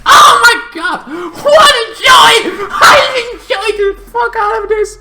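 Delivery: very high, loud gushing shriek; overjoyed high yelling, near laughter; ecstatic shout. Real, not acted. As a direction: squeal with joy over the episode. Gushing over the show.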